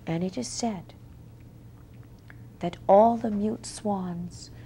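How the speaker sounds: soft, hushed, whispery close of a tale; quiet fairy-tale ending, softly fading; hushed storytelling. A narrator's reading. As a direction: draw the listeners in with a near-whisper.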